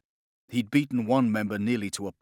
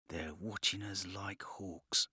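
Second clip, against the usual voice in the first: softer, slower, kept under the breath; hushed warning; 3 semitones lower, 11 dB quieter, slower than usual. Acted. Nathan warning the others about the guards, hushed and slow. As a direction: keep it low so the guards can't hear.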